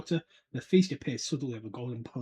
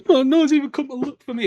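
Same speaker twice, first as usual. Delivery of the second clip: high, louder self-pitying wail; mock whiny Hermione; 11 semitones higher, 12 dB louder than usual. Hermione sulking alone in the toilets, mock wail. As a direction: whine like a neglected girl. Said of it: "poor Hermione's like".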